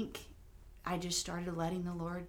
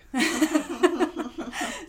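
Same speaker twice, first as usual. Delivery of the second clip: sudden high laugh; loud laugh; 10 semitones higher, 13 dB louder than usual. Real, not acted. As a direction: laugh at being teased. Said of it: "It's 'cause you're prophetic".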